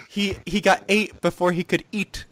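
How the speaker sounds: high mock girl voice. Haruka gasping line.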